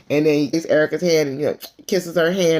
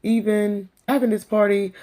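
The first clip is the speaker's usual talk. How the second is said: mocking Lisa; 5 semitones higher than usual. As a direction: imitate her self-pitying sigh. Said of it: "talking about".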